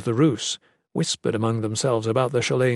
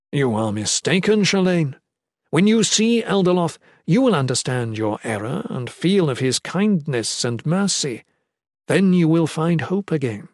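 higher, emphatic voice of a believer; courteous captor, devout assurance; 4 semitones higher than usual. Acted. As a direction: correct her with fervent faith.

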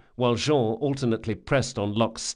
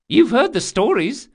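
put-on anxious boy voice; 13 semitones higher, 7 dB louder than usual. Bug the boy.

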